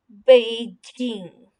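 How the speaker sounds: बीजिंग slow and clear, falling at the end; Beijing blended. Shown as a demonstration. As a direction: blend बीजिंग into one smooth word. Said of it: "मिला के हम पढ़ते हैं". (बीजिंग धीरे, जोड़कर)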